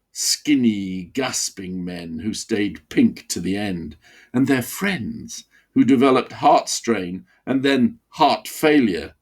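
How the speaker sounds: ordinary delivery, nothing marked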